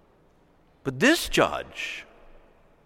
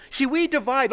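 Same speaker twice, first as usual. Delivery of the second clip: ordinary delivery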